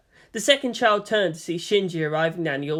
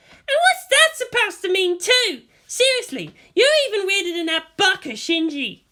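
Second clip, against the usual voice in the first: put-on much higher, louder exasperated girl's voice; exasperated Asuka; 14 semitones higher, 4 dB louder than usual. A narrator's reading. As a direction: snap back, baffled and annoyed.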